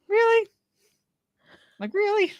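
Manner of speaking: very high disbelieving really; incredulous. Retold or imitated. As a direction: ask it in utter disbelief. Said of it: "I'm like".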